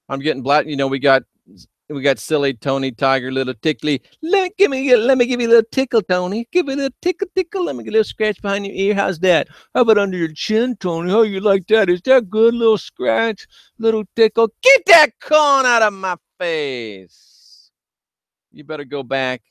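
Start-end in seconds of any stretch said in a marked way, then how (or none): 4.2-14.5 put-on high, fast cooing baby talk to a cat; high sing-song voice for a pet. 14.6-17.7 mock scolding. Scolding the cat.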